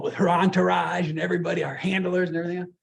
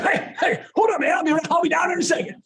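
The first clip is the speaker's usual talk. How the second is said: raised, loud and hurried; excited retold reply; 8 semitones higher, 5 dB louder than usual. Player answering the surprise show invitation, excited. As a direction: blurt it out, higher and louder.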